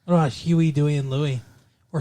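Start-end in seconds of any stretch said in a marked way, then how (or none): none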